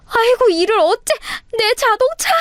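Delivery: very high, hammed-up wail; mock wail. Sister-in-law wails over her flooded sports car, high and hammed-up.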